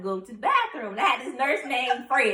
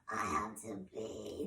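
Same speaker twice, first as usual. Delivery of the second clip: very low, faint, slow croak; imitated weak, lost voice; voiceless after tubes; 13 semitones lower, 14 dB quieter, much slower than usual. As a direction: barely get it out, like tubes just left your throat. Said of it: "'Cause that's how I sounded".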